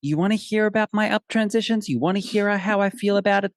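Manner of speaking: high-pitched, sassy horse talking back; hammed-up challenge, pitched well up; indignant retort. Indignant horse talks back, retold.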